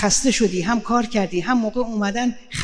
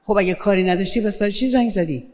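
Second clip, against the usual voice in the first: ordinary talk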